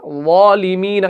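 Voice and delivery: वालिमीन with full, rounded heavy sounds, slowly; heavy full-mouth reading. Shown as a demonstration. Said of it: "यानी हम इसको मुंह भर के पढ़ते हैं".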